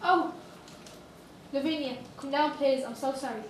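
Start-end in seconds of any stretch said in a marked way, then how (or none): 0.0-0.3 surprised. Acted. Sees Lavinia in the tree.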